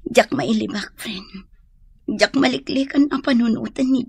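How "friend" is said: whispered. Acted. Admitting to friend.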